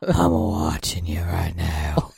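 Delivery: creepy man voice. Pretend. Teasing her friend as a creepy stalker, deep and slow. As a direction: play a lurking creep, deep and drawn out. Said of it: "That's the creepiest voice ever".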